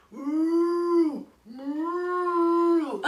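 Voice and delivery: long, high swooping moo; playful moo. Pretend. Storyteller pulling the bull from the bag, long mooing. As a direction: moo out like a cartoon cow.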